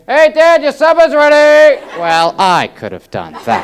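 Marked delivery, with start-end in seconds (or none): 0.1-1.8 high, loud call to Dad; kid's yell. Retold or imitated. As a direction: bellow it across the house like a kid. Kid yelling for father.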